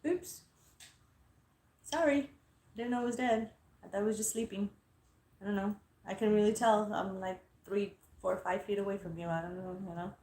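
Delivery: small, soft-voiced embarrassed excuse; sheepish apology. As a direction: back off quietly, apologizing. Embarrassed apology after being hushed.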